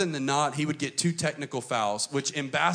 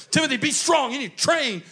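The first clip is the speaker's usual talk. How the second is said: much higher, louder drill-coach urging; coach pep talk; 10 semitones higher, 6 dB louder than usual. Retold or imitated. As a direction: bark orders like a coach. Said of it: "He's like".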